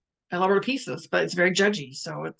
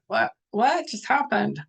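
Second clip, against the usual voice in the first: mock surprise; 4 semitones higher, slower than usual. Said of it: "you're like".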